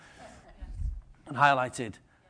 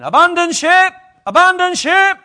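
panicked shout, higher and much louder; 12 semitones higher, 13 dB louder than usual. Retold or imitated. Disciple yelling to crew as the boat floods, panicked shout. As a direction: bellow it like the boat is sinking. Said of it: "imagine him shouting".

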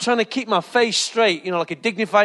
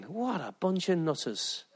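much softer, slow aside; muttered thought; 13 dB quieter, slower than usual. Retold or imitated. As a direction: say it under your breath, slowly. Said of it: "they're thinking".

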